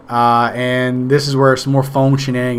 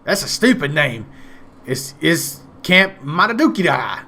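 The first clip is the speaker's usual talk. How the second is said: higher, mocking old vampire voice; scoffing grandpa; 4 semitones higher than usual. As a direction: scoff at the silly name like a grumpy grandpa. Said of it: "He goes".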